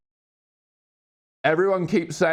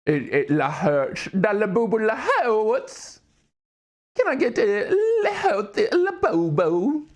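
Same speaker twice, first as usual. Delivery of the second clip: iDubbbz; 6 semitones higher than usual. Pretend. Streamer riffing on a boo-boo joke, goofy weird-talking voice. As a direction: stretch the vowels in a silly swooping voice. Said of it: "that way of speaking".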